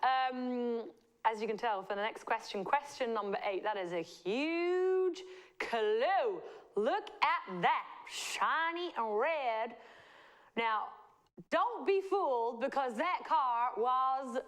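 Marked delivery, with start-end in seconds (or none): 3.6-14.4 put-on slow, drawn-out Southern accent; Southern American accent. Host hinting at the next question, Southern accent. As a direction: stretch it out in a Southern drawl. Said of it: "I should stop with the Southern accent".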